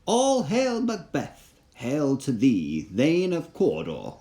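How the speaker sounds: an uncanny crone greeting, drawn out; second witch's voice. Acted. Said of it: "said the th-, second".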